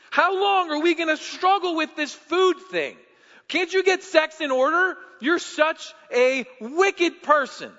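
loud, high, scolding voice; preacher voicing an imagined God who shames the sinner, loud scolding; mock accusing God. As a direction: berate them like a harsh judge. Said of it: "God showing up and saying".

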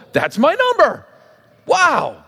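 high, amazed reaction; acted amazement. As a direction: play a winner stunned by a match. Acting winner seeing first number match.

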